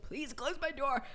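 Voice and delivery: soft, strained mock plea through a laugh; barely getting words out. Retelling a plea to shut the bedroom door, barely getting words out. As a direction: imitate squeezing out a hushed plea. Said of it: "barely getting words out".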